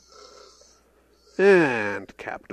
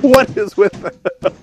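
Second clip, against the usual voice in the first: laughing; 10 semitones higher, 11 dB louder, much slower than usual. Real, not acted. Laughing at the film blooper.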